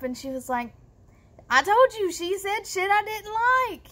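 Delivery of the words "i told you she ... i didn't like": high, insistent acted-out comeback; imitating an annoyed sister's high retort; defensive retort. Sister snapping back at questioning.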